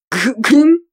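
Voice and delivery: strangled throat grunt; a choked hmm through coughing. Acted. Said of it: "отозвалась я".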